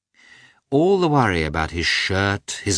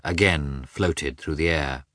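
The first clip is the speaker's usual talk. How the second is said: plain reading voice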